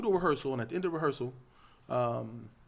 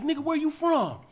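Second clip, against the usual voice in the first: mock yell; 12 semitones higher than usual. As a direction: imitate a yelled confrontation, pitched up. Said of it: "he was yelling at me".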